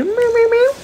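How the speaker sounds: very high, loud meowing for the cat; loud high cat impression. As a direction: answer as the cat with shrill meows. Voicing cat's reply.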